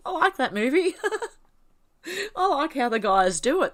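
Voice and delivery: high laughing voice. Real, not acted. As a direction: laugh through the words.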